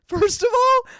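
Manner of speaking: hammed-up outrage to manager; mock shout. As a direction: blast the opener at the boss. Retorting angrily to manager.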